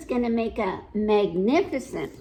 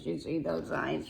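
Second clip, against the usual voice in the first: ordinary delivery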